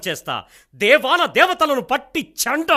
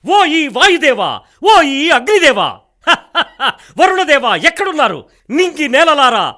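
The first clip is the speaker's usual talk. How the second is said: furious summons; 4 semitones higher, 7 dB louder than usual. Acted. King Mallanna challenging the elemental gods, furious loud shouts. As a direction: roar a challenge at the wind and fire gods.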